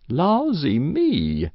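put-on high, slow old woman's cry of dismay; startled exclamation. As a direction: draw it out in country astonishment. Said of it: "exclaimed Missus Race".

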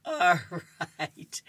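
laughing. Real, not acted. Still laughing after the joke.

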